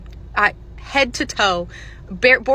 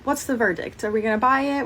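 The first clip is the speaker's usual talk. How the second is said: ordinary delivery, nothing marked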